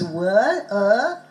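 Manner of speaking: "wor-, er" with a long central ER vowel; drawn-out ER vowel. Shown as a demonstration. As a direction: isolate the vowel of worse, "er", slowly. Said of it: "Same, O R spelling".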